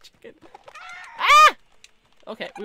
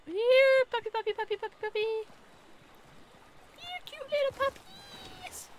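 high sing-song coaxing, much higher, softer; coaxing pet call; 11 semitones higher, 4 dB quieter than usual. Real, not acted. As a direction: coo to the puppies like calling a real dog. Calling puppies in game.

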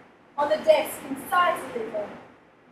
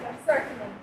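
ordinary delivery

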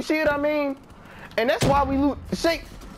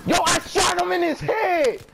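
high, yelled disbelief; outraged shout; 8 semitones higher than usual. Real, not acted. Gamer yelling that his headshot didn't count, outraged shout.

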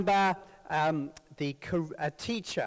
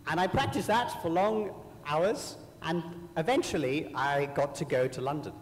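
ordinary delivery

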